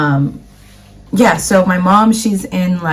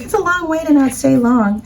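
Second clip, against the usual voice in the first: higher mock mom protest; 8 semitones higher than usual. Daughter imitating her mom objecting to a short trip. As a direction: pitch up, a bit indignant.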